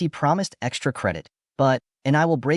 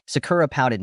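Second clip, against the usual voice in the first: ordinary delivery, nothing marked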